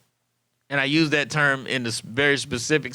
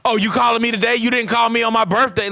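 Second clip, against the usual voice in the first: higher, louder, whiny complaint; guilt-trip him about the birthday; hammed-up hurt feelings; petty sulk; 6 semitones higher, 4 dB louder, faster than usual. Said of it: "have an attitude".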